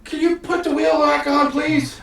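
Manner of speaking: very high, loud squeaky plea while lifting; old halfling lifting his cart and asking for help, very high and loud; straining old halfling. Acted. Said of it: "It's like".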